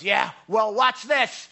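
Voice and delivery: loud, high defiant dare, imitated; mock defiant shout. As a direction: act out an angry man shouting a challenge. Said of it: "he starts shouting".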